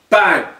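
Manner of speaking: loud, high shouted bang; vocal gunshot, sharp and loud. Acted. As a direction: punch it out like a gunshot. Gunshot in kneecapping scene.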